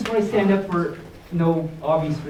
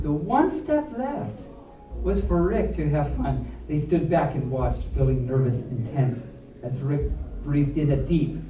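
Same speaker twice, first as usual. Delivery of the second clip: put-on reading to children; 4 semitones lower than usual. A narrator's reading. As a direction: build the suspense for the kids. Reads picture book aloud.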